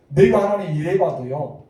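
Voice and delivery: mock indignant listing of one's own merits; self-justifying protest. Preacher imitating a boastful prayer to God, self-justifying protest. Acted boast Moses did not make.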